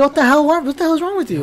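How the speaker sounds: high-pitched mock disbelief; retold exasperation. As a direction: react as if appalled by the sudden accent. Retold exasperated reaction to the accent.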